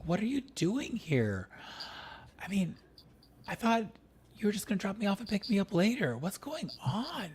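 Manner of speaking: startled and confused grandson; Brandon surprised. Acted.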